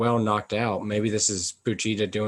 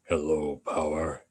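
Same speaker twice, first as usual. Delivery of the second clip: very low, comically deep greeting; outrageously deep voice; 9 semitones lower, 4 dB quieter, slower than usual. Acted. Voicing the dog greeting Power, outrageously deep. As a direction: drop way down into a deep mock character voice. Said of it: "have an outrageously deep voice".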